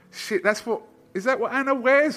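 high, loud mock disbelief; retold surprised thought. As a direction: replay your startled double-take as a question. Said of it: "in my head, I was thinking".

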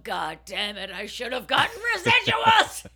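hammed-up bitter rant; mock bitter adman. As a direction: yell it like a cheated old adman. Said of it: "somewhere going".

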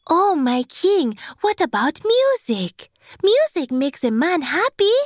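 high, wonder-filled voice of an adviser; high-pitched, hopeful servant; eager worker. A narrator's reading. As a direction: coax the king with storybook delight. Voices another worker pitching music.

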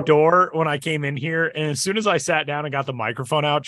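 normal talking voice

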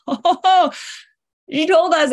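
high, loud "Oh", a flash of understanding; sudden realization. Retold or imitated. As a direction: blurt it out as the penny drops. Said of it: "it was like".

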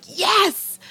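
high-pitched burst of glee; gleeful yes. Acted. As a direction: let slip a delighted, high yes. Acting out glee at declines.

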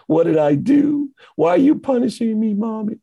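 put-on high, plaintive little-kid voice; crying child. Slapped child asking his mommy why she punished him, high plaintive whine. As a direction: play a hurt three-year-old pleading with mom. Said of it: "and they cry".

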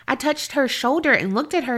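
usual speaking voice

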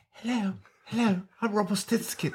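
high, put-on creature voice; Rumpelstiltskin impression. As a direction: mimic a high-voiced imp introducing himself. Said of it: "and then other times he's like".

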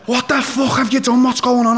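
high, loud imitation of an outraged boyfriend; alarmed confrontation. Retold boyfriend running out, confronting him.

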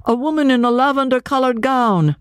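high-pitched, insistent character voice; urgent pursuer. A narrator's reading. Pursuer describing the runaway to a policeman, urgent.

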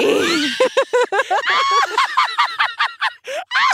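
shrieking burst of laughter; loud high laugh. Real, not acted. Laughing at spelling mix-up.

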